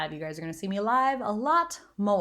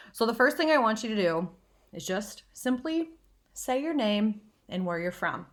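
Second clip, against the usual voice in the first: ordinary talk, nothing marked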